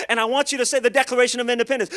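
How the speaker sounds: fast, loud mock preacher with run-on words; mock preacher, rushed. Imitating a TV preacher, rushed and loud. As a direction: rattle it off like a preacher who won't stop. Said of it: "his words got so long".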